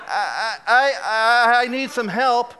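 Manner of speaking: loud, stammering mock cry for help; mock panic. Recalling watching the spill helplessly, mock panic.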